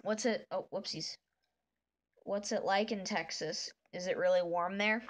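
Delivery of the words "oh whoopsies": ordinary talk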